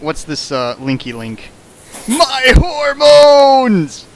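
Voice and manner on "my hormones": much higher, louder, dragged-out wail; hammed-up shriek of despair; mock anguished cry. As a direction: stretch it out like a hammed-up melodrama cry. Mock outburst while reading.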